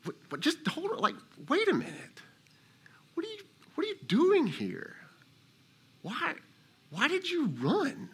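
God gently questioning the runaway, high and soft; voicing God. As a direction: speak as God, high and hushed. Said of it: "and God says to you".

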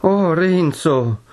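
high, drawn-out cry of dismay; exclaiming. A narrator's reading. Lucia exclaims at her betrothed, high and drawn out. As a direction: stretch the name out in alarm. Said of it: "esclamò Lucia".

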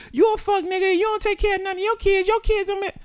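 mock cousin telling him off. Cousin cussing out the boyfriend on the phone, high mock voice. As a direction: imitate the cousin going off on him.

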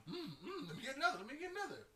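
hammed-up eager begging for seconds; eager drinker. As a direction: play it up, pitched high and keen. Acting out craving more drink.

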